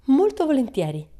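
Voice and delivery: high, loud, bright-eyed yes; eager. A narrator's reading. Pippi answering the teacher, high and eager. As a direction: jump at the chance.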